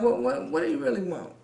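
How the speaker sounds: suspicious inner voice. Retold or imitated. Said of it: "I'm like".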